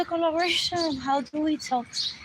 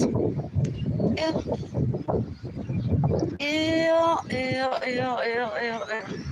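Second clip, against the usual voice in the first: disgusted; much slower than usual. Real, not acted. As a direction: say ew in disgust, fading out. Disgusted ew, fading.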